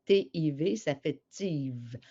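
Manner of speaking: T, I, V spelled slowly, then joined as TIVE; slow decoding of "tive", letters then whole syllable; sounding out syllable. Shown as a demonstration. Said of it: "et l'autre syllabe, ça fait".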